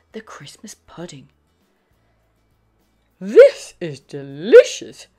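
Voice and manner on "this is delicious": loud, delighted swoops on stressed syllables; Mummy Pig. A narrator's reading. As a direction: relish the food loudly. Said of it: "snorts Mummy Pig".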